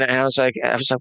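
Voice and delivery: ordinary delivery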